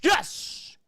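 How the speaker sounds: high, loud burst of joy; excited cheer. Real, not acted. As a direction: cheer the tying bucket. Tying shot goes in.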